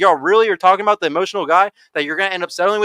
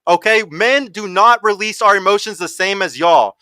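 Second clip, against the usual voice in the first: ordinary talk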